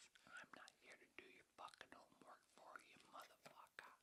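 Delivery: hushed conspiratorial whisper, voice off; whispered aside. Acted. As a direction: lean in and whisper the explanation.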